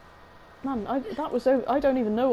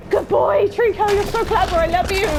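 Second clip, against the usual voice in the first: high, loud doting praise to a pet; gushing praise; 8 semitones higher, 8 dB louder than usual. Real, not acted. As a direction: coo over the animal out loud. Praising the creature Trico.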